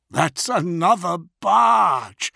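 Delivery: usual speaking voice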